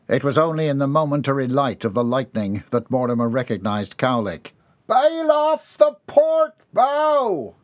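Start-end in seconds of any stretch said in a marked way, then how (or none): 4.9-7.6 high, slow shouted command; crewman's order. A narrator's reading. Crewman ordering Mortimer in the storm, high slow shout. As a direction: bark it across the storm, high and drawn out. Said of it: "the crewman ordered".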